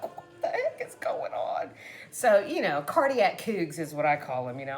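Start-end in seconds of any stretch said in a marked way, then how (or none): 0.0-1.7 high, airy, laughing squeal; retold laughing disbelief. Fan reliving a shock comeback, laughing disbelief. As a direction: drag it out, voice nearly gone with laughter.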